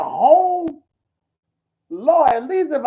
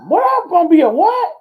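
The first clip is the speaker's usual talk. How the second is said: loud, high incredulous what; retold stunned outburst at being called an apostle; shocked disbelief; 10 semitones higher, 9 dB louder than usual. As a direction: blurt it out like you can't believe your ears. Retelling shocked reaction to prophecy.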